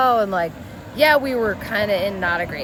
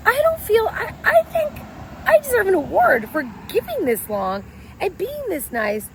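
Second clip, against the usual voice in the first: exasperated; 11 semitones higher than usual. Retold or imitated. Exasperated self-praise to husband.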